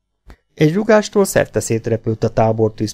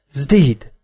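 short raised exclamation, pitched up; surprised cry; 4 semitones higher, 4 dB louder than usual. A narrator's reading. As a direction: call out in mild surprise. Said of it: "meglepett felkiáltást hallott".

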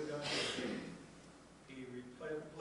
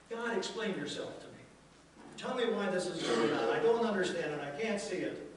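higher and louder, pleading with God; frustrated plea; 4 semitones higher, 6 dB louder than usual. Retold or imitated. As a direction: press God for answers like a confused believer. Voicing our frustrated prayers.

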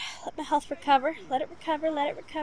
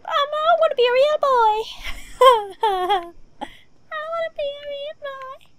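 put-on high wailing plea to be a real boy; Pinocchio plea; 12 semitones higher, 5 dB louder than usual. Gamer playing a zombie begs to be human, high wail. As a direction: whine it like a puppet begging to be real.

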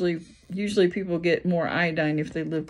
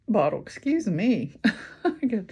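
talking through the hiccups; hiccuping between words; 3 semitones higher than usual. Real, not acted. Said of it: "I'm hiccuping".

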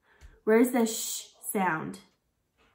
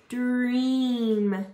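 "Dream" drawn out, sounds stuck together; slowly blended word. Shown as a demonstration. Teacher blending sounds for kids, "Dream" stretched slowly. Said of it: "you're going to stick it together".

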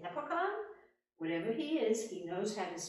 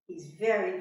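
ordinary delivery, nothing marked

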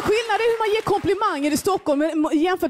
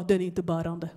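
much lower, flat-sure workman voice; low and softer, utterly certain; deadpan tradesman; 10 semitones lower, 6 dB quieter than usual. Retold or imitated. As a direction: craftsman brushing off the worried customer, low deadpan. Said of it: "Han bara".

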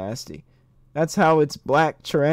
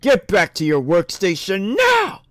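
loud, raised-pitch command; barked order; 6 semitones higher, 5 dB louder than usual. Acted. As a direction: shout it like an angry boss. Reading an angry game character's order.

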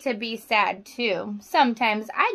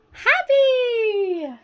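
bright, much higher burst; happy; 11 semitones higher than usual. Shown as a demonstration. Teacher showing the class a happy face, high and bright. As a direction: sing out the word like pure joy. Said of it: "The smile you see upon my face".

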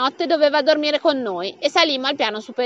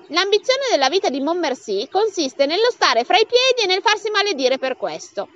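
usual speaking voice

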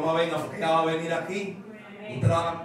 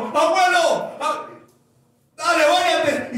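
excited call; 11 semitones higher, 8 dB louder, slower than usual. Retold or imitated. Child begging grandfather in rain.

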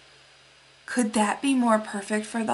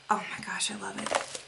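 delighted whisper; 12 dB quieter than usual. Real, not acted.

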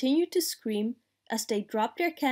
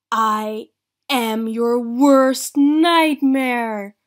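loud, very slow monster growl; menacing growl; 12 dB louder, much slower than usual. A narrator's reading. April scaring a dragon from behind the curtain, menacing growl. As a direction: growl it out long and loud to terrify. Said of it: "growled April".

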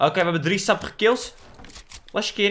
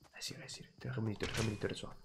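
much softer, voiceless whisper; tense whisper; 7 semitones lower, 22 dB quieter, much faster than usual. Real, not acted. Gamer hiding from an enemy, whispering to teammates. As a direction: whisper so the enemy won't hear.

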